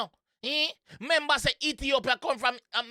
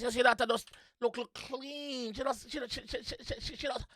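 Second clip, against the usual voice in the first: trails off much softer; fading mumble; 8 dB quieter than usual. Real, not acted. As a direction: let it die to a murmur.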